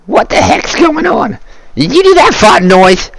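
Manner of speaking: a goblin demanding answers; high goblin asking what happened; suspicious goblin. Acted.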